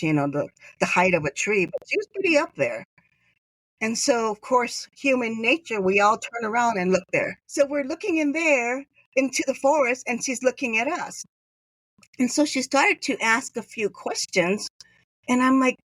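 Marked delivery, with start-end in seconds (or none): none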